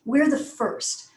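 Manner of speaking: slow, deliberate "first"; stretched key word "first"; emphasis on FIRST. Shown as a demonstration. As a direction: make "first" stand out, taking your time. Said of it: "Emphasize very key words".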